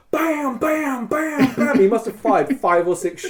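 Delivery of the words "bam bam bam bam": high, loud mouth gunshots; gunshot sounds. Shown as a demonstration. Storyteller imitating pistol shots, loud mouth gunfire. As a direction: bark out the shots.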